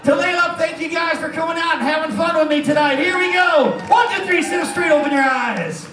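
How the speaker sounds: rally the crowd as the show closes; rousing showman shout. Real, not acted. Hypnotist wakes subjects, big finale.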